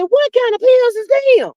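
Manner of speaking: high-pitched, incredulous retold question; hammed-up, high-voiced astonishment; mock disbelief. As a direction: squeal it out in exaggerated bafflement. Acting out her baffled reaction.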